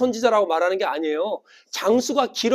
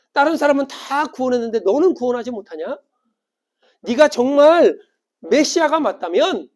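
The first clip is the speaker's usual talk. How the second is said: jeering crowd, pitched up; mocking challenge; 5 semitones higher, 6 dB louder than usual. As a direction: taunt him to prove himself. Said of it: "조롱하는 거예요". (조롱하며 따지듯)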